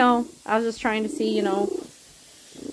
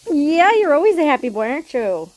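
gushing praise; 3 semitones higher, 6 dB louder than usual. Real, not acted. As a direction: praise him like the best cat ever. Praising cat.

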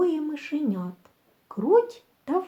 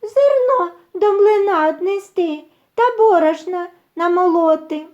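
high, louder mouse voice; little mice; 7 semitones higher, 5 dB louder than usual. A narrator's reading. Mice send rooster to the mill, high voice. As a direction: pipe out instructions like a small mouse. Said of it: "кажуть".

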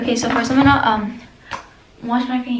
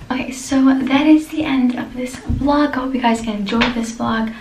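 usual speaking voice

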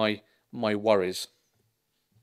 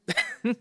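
short high laugh; 13 semitones higher than usual. Real, not acted. Laughs after the Shipman joke.

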